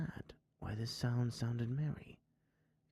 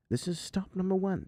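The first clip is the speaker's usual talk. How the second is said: hissed; 6 semitones higher, 4 dB louder than usual. Acted. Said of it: "the old Grinchy Claus hissed".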